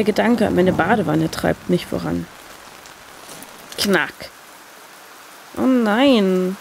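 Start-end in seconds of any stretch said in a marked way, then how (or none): none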